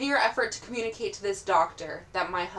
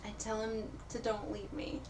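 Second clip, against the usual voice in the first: usual speaking voice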